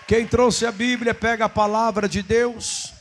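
ordinary talk, nothing marked

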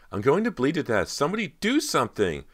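high, loud panicked wail; terrified scream, bleeding; screaming in agony. A narrator's reading. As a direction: scream it out in pain and terror. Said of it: "Persia screamed and cried".

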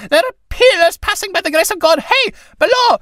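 very high, loud call for help; crying out. A narrator's reading. Priest calling out to the police, high and loud. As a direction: yell to the passing police. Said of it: "crying out".

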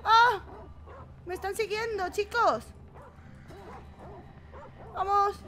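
panicked while fleeing wolves; being chased. Real, not acted. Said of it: "ya me estoy poniendo nerviosa".

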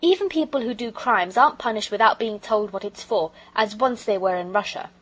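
put-on loud, indignant boy's complaint; protesting boy. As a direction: argue it out as a wronged child.

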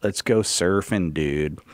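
put-on slow, low surfer-dude American accent; mock American accent. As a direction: exaggerate a Californian surfer accent, sinking on the last word. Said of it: "Nobody's going".

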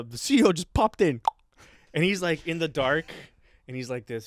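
usual speaking voice

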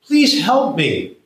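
high, drawn-out plea from the ditch; calling up for help. Acted. Drunk in a ditch calling up to the lawyer, high pleading. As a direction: call up high and slow, begging. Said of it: "Tommy yells up".

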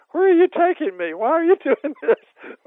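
put-on high, loud cries of an old woman; loudly protesting being wheeled off; imitating Nell's loud objection as she's wheeled out; mock loud protest. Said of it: "Nell very loudly saying".

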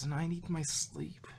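whisper. Acted. Exhausted Twilight trails off.

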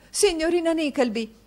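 high alarmed call up the stairs; a frightened wife calling the girl; cry out. A narrator's reading. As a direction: shout for help in a panic. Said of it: "gridò a quel rumore madama Mantalini".

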